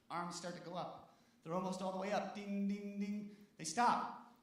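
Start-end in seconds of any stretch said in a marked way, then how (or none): 2.3-3.3 crossing bell imitation. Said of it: "imitating bell again".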